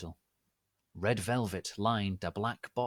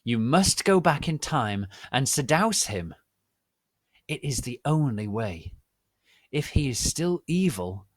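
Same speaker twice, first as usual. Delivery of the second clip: loud command; 4 semitones higher, 7 dB louder than usual. Acted. Professor commanding Ebony's mission.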